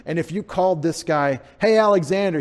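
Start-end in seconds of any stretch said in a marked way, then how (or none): 1.6-2.4 loud, higher hail to someone; calling out. Pretend. Pretending to call this guy by name, calling out. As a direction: call him from across the way.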